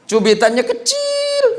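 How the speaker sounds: tiny pinch, tiny high voice; squeaky punchline. Acted.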